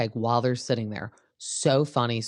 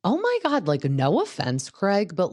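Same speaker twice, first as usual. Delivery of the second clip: shocked; 7 semitones higher than usual. Retold or imitated. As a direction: gasp it high in disbelief. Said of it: "And I'm like".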